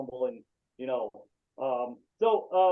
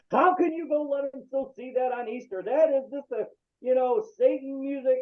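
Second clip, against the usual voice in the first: a scolding grandmother, voiced higher; disapproving grandmother; 8 semitones higher than usual. Grandmother scolding about Satan music, voiced higher. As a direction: play the shocked churchgoing grandmother scolding. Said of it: "and my grandmother".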